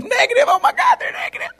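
very high, wailing panic; hammed-up patient wailing at terrible news; mock horror. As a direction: squeal it in pretend despair. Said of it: "he was thinking negative being bad".

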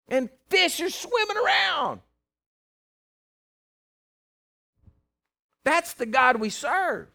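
high, loud preacher's cry; ringing, high-pitched pulpit proclamation; full-force preaching. Acted. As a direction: preach it big, drawing out the key words.

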